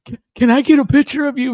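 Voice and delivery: imitated starstruck stammer, high and loud; high, jittery mock fan; shaking with excitement. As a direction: stumble into a nervous fan request. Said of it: "he's, like, shaking".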